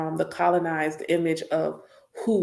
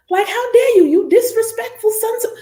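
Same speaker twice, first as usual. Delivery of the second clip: outraged mother; 9 dB louder than usual. Acted. Voicing angry mothers' outrage.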